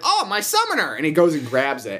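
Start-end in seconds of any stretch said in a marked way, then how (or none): 0.0-0.9 high, gleeful imitation of the boy; mock delighted cry. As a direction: squeal it like a kid finding treasure. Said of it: "And he's like".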